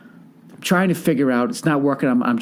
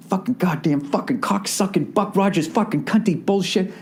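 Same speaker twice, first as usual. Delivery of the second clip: strained, higher-pitched mock tirade; cursing a blue streak; 6 semitones higher than usual. Cursing to himself leaving the ticket window, mock-furious rant. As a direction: re-enact swearing your brains out under pressure. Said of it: "cursing my fucking brains out".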